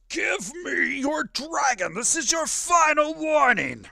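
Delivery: full-force, high screamed ultimatum; Father Bracken screaming. Acted. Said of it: "Father Bracken screams".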